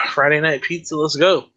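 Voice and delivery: ordinary talk, nothing marked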